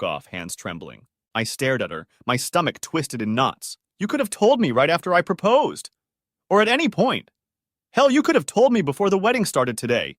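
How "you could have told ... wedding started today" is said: rising frustration, high and loud; frustrated. A narrator's reading. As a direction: let the frustration break out. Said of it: "The frustration in my voice was building".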